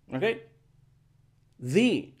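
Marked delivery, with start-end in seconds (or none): 1.6-2.1 "The" said as thee; "thee" vowel. Shown as a demonstration. Teacher shows article before vowels, thee. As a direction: end "The" on a clear ee. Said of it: "ahora es con vocal I".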